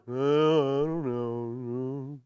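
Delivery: low, very slow, sagging mope; gloomy Eeyore. Retold or imitated. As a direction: drag it out like Eeyore.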